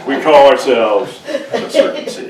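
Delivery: slow, proud band name through a laugh; laughing band intro. Pretend. Preacher jokes to congregation, slow laughing announcement.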